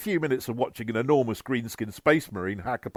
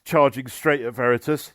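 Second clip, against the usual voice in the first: ordinary delivery, nothing marked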